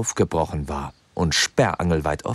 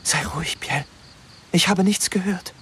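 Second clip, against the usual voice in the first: nervous reply; 12 semitones higher than usual. A narrator's reading. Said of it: "antwortete Catalina nervös".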